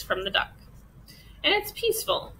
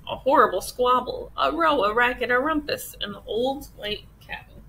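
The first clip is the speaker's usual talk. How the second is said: ordinary delivery, nothing marked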